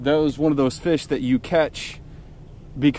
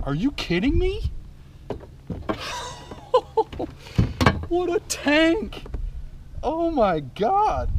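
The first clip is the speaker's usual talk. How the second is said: much higher, slow and breathless; giddy disbelief; slower than usual. Real, not acted. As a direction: squeal with delight. Amazed by the fish.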